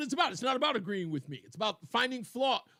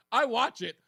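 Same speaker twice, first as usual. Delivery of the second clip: usual speaking voice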